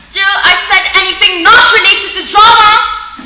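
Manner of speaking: slow, loud, high-pitched yelling; outraged shout. Acted. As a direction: yell after her, stretching the words. Father yells as daughter stays silent.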